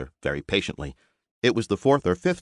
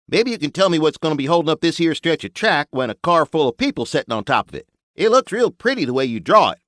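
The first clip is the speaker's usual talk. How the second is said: folksy builder; 8 semitones higher, 5 dB louder than usual. Acted. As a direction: talk like a blunt country mechanic. Buster arguing with maestro.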